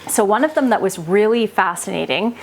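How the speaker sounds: normal talking voice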